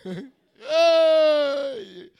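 put-on high, loud, long drunken whoop; drunken cheer. Imitates himself drunk at drive-through.

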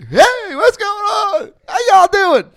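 high, loud, drawn-out mock American greeting; hammed-up loud tourist hello, stretched slow; loud brash American. Speaker mocking himself greeting Italian in-laws, loud mock American. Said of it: "American, right?".

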